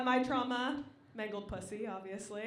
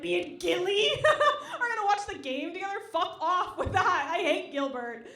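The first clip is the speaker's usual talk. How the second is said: higher, louder sneering echo; mock-outraged retort; mocking scorn; 6 semitones higher, 7 dB louder than usual. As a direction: throw her words back with scorn. Mocking repeat of friend.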